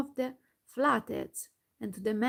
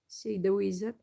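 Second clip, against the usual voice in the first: plain reading voice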